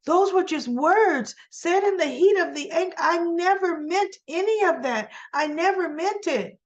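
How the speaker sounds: imitating a flustered denial; a lightly voiced defensive plea; pleading Diane. Diane pleading in heated confrontation.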